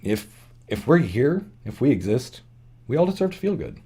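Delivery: fighting back tears while speaking; choked up. Real, not acted. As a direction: voice tight with real feeling, pausing to steady it. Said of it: "I'm getting choked up".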